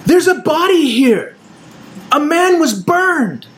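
German guard yelling about a burned body; frantic German soldier. Acted. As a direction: shout the discovery in alarm. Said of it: "And in German".

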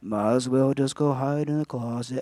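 hopeless mumbling mope; Eeyore. Acted. Preacher mimicking the glum donkey, Eeyore voice. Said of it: "Remember Eeyore from Winnie the Pooh?".

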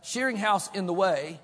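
normal talking voice

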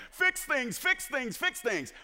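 high-pitched, repetitive pestering; mock demanding prayer. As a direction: nag God in a squeaky-high imitation. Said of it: "I've treated him like the glorified Tim the Toolman Taylor".

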